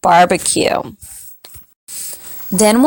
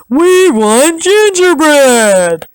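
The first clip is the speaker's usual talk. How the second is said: slow cattle voice; 7 semitones higher, 4 dB louder, slower than usual. Acted. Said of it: "they all cried".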